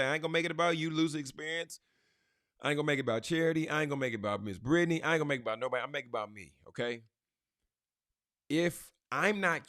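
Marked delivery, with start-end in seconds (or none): 0.0-5.8 rapid playful list. Real, not acted. Preacher to congregation, rattling off names fast.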